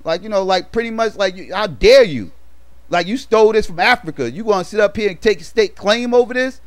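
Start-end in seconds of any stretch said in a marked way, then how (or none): 1.4-6.6 high, heated retold tirade; going in on her. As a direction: lay into her, pitch climbing high. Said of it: "he started going in".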